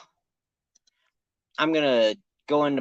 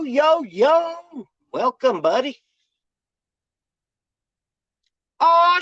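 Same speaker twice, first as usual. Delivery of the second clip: squeaky high voice; 4 dB louder, much slower than usual. Acted. Goofy greeting to new guest.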